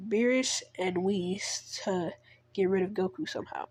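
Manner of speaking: ordinary delivery, nothing marked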